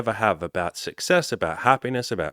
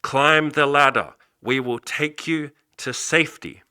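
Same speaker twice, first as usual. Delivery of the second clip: pilot yelling out; 6 semitones higher, slower than usual. Retold or imitated. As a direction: call down slowly from the helicopter. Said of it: "he yells out".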